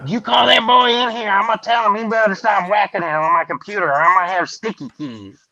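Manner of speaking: high-pitched hillbilly rant; mock-outraged hillbilly holler. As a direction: scold like a hammed-up hillbilly dad. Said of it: "I played the regular American hillbilly".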